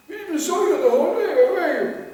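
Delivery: amazed question. Retold or imitated. Devotees disbelieve his book count.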